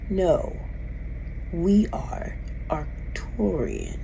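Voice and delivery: slow, even whispered reply; alien whisper. Retold or imitated. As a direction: hush it out like a voice in your head. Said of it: "Said it just like that".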